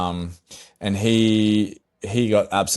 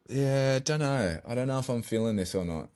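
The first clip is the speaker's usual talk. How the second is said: retold hesitation; 3 semitones higher, 8 dB quieter than usual. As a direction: hedge it quietly, not committing. Retold hesitant answer to friend.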